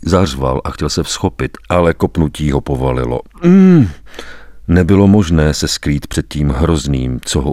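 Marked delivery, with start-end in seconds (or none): 3.4-3.9 sharp high groan of pain; a high, loud yelp of pain. Acted. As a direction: let out a short cry as the blow lands. Beaten man cries out in pain.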